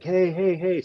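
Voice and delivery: high-pitched retold call for attention. Man in parking lot drawing onlookers' eyes, calling out. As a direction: mimic calling out to bystanders.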